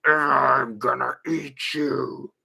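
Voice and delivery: slow, whispered monster-clown threat; creepy monster clown. Acted. Describing scary monster clowns, slow creepy whisper. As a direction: hiss it like a clown under the bed. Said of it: "By monster clown, here's what I mean".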